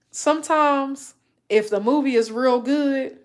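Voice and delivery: usual speaking voice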